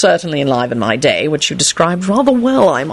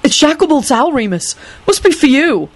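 raised boyish voice, lightly played; young Neville voice; 10 semitones higher than usual. Neville tells Remus the owl is for him, boyish voice.

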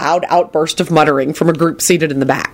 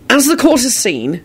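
raised pitch, determined courtroom statement; 9 semitones higher than usual. A narrator's reading. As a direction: speak up with resolve as defense. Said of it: "Harry said determinedly".

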